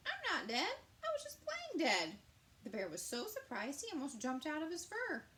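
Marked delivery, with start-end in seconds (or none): 0.1-2.2 put-on much higher, louder child voice; little possum voice. Joe telling the bear he's alive. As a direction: squeak it like a tiny cheerful possum.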